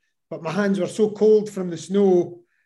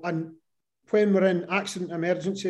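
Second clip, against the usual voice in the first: ordinary talk, nothing marked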